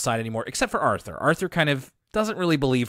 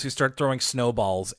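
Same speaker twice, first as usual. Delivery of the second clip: ordinary delivery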